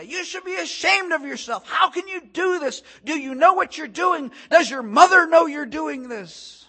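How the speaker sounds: high, loud outraged scolding; angry yelling. Retold or imitated. Angry woman confronting a man handing out gospel tracts, yelling. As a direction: lash out with rising volume, question after question. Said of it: "She began to yell at me".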